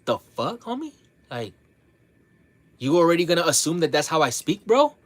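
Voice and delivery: rising, indignant "bro?"; incredulous retort. Retold or imitated. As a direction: push back in loud disbelief. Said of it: "I'm just like".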